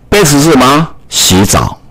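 ordinary talk, nothing marked

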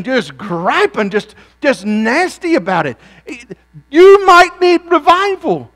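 preaching at full force. Acted. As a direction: thunder it at the congregation. Preacher scolding grumbling churchgoers.

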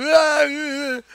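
short high burst of real laughter. Real, not acted. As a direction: laugh out, genuinely tickled. Amused by opponents freaking out.

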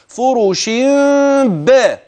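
فرش باء with a light lip closure and a small gap; iqlab demo. Shown as a demonstration. Teacher demonstrating the lip position for the hidden meem. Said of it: "كيف يكون النطق هكذا؟".

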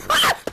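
sudden high-pitched shriek; a jump-scare yelp; startled scream. Real, not acted. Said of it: "That scared me so bad".

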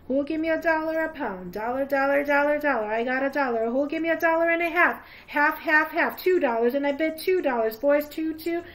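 auctioneer's call. Acted. Auctioneer calling out bids to the miners, loud and fast. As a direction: rattle off bids to the crowd.

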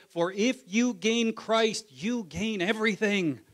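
usual speaking voice

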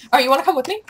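ordinary talk, nothing marked

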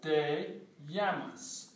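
"Te llamas" very slow, llamas like "yamas"; slow demo. Shown as a demonstration. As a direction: stretch out "Te llamas" for learners. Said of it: "pronunciation demo".

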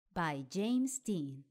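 usual speaking voice

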